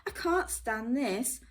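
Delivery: loud, high exasperated complaint; fed-up Mister Bear. Acted. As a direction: grumble it out, fed up.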